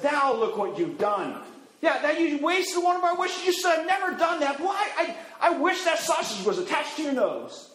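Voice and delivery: husband scolding his wife for the wasted wish; exasperated husband. Acted. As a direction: blame her for wasting a wish. Husband scolds wife over sausage.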